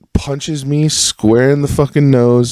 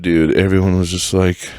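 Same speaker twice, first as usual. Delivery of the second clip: usual speaking voice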